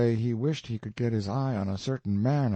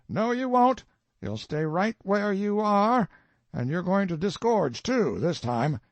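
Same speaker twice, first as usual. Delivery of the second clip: higher, louder insistent collector; stern refusal; 11 semitones higher, 4 dB louder than usual. A narrator's reading. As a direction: cut him off and pin him down. Collector refuses colonel's dodge.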